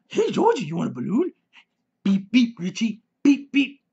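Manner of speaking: high, sing-song creepy clown voice; Pennywise clown. Acted. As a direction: lure the kid like a sinister clown. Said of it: "Or is that the original?".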